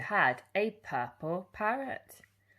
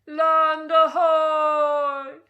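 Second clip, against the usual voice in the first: very loud, very slow, high lookout shout; drawn-out pirate lookout cry; shouted call; 11 semitones higher, 14 dB louder, much slower than usual. A narrator's reading. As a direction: call it down from the crow's nest. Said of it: "a voice from the crow's nest shouted".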